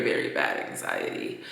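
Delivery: whispered low confession; whispering. Real, not acted. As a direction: drop to a whisper. Confessing anxiety.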